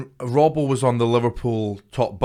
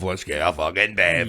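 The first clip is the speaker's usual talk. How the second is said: mock Scottish bloke; 6 semitones lower than usual. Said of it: "national attitude towards bevy".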